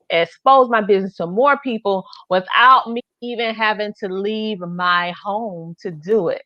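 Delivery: normal talking voice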